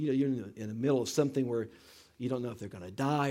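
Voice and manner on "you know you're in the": ordinary delivery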